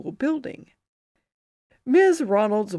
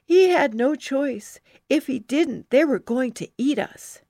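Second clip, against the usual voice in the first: sighing matter-of-fact; 6 semitones higher, 5 dB louder than usual. Acted. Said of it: "I sighed".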